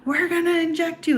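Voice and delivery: security guards threatening her, in a mocking high voice. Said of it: "And they're like".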